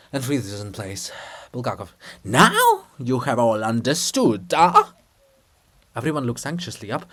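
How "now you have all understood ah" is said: menacing. Acted. Bulgakov menaces the frightened crowd.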